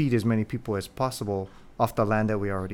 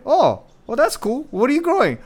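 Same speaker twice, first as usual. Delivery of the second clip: high, bright fake interest; mock polite interest; 13 semitones higher, 5 dB louder than usual. Host imitating the group's polite reply to the farmer. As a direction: chirp it like polite small talk. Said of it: "we all went".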